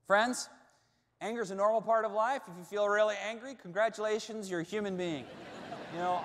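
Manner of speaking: rally the congregation like a preacher; preaching. Acted. Preaching climax.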